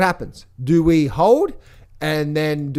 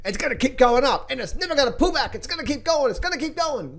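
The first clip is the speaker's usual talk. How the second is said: mock viewer; 7 semitones higher than usual. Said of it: "look where we are".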